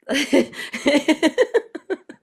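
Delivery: high, rippling laugh; real laugh. Real, not acted. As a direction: break into a high laugh before carrying on. Speaker laughs before introducing herself.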